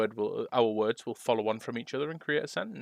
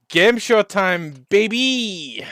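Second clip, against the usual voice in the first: game show host; 7 semitones higher, 9 dB louder, much slower than usual. Acted. Hyped game show opener.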